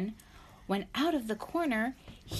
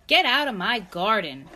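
louder, emphatic storybook grouch; grumpy order; 2 semitones higher, 9 dB louder than usual. A narrator's reading. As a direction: order someone off, mildly cross.

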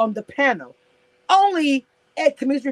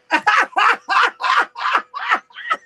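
shrieking laugh; 14 semitones higher than usual. Real, not acted. Vlogger cracking up at her friend's clip, shrieking laugh. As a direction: burst out in a real squealing laugh.

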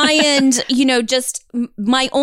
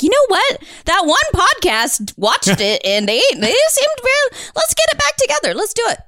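high-pitched mock enthusiasm, rising as the idea lands; imitated excited pitch; 9 semitones higher than usual. Host imagining the show's makers deciding to reunite, mock excited. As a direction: act out someone eagerly hatching a plan. Voicing show makers inspired by podcast.

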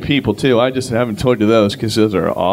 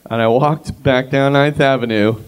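ordinary talk

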